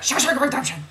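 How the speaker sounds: high, rapid comic blurt; Jim Carrey. Retold or imitated. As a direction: spit the title out in a manic rush. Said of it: "I have to say it like that".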